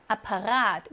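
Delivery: 아파라트 stressed on the long 라; end-stressed demo of 아파라트; last-vowel stress. Shown as a demonstration. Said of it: "마지막 모음의 강세가 있습니다".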